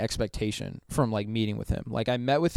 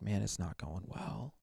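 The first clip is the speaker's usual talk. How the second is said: much softer, slower, nearly swallowed; dejected admission; 3 semitones lower, 14 dB quieter than usual. Retold or imitated. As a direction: let the words sink out of you. Confessing youth group failing.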